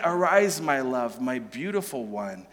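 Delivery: soft and low, sinking away; tender. Retold or imitated. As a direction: say it gently to the beloved. Tender quote of the lover.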